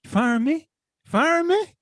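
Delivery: high incredulous rising repeat; mock outrage. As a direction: scoff at the threat, voice climbing. Retold defiance at boss.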